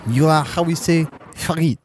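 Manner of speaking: lightly put-on French accent; a cartoon Frenchman being charming, lightly done; joking French accent; mock French accent. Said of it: "Now French".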